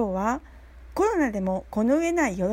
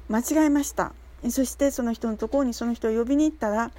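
ordinary delivery

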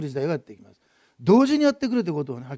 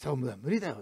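a soft, lower, sly little voice; small voice of Satan; 4 semitones lower, 7 dB quieter than usual. Retold or imitated. Satan whispering doubt to the believer, soft sly voice. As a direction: slip the doubt in quietly, like a tempter. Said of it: "うまく小さく、最初は小さな声で".

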